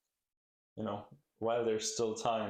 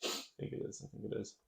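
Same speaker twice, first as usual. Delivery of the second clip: muttering; 8 dB quieter, much faster than usual. Real, not acted. Teasing mutter.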